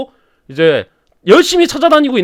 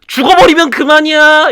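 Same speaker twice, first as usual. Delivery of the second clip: slow, higher defiant drawl; defiant Gorlim; 8 semitones higher, 4 dB louder than usual. Acted. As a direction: shrug off the torture. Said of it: "고를림은 얘기해요".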